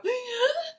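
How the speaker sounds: high, audible gasp in imitation; sharp retold intake of breath; imitated gasp. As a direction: mimic a friend gasping down the phone. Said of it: "you hear her go".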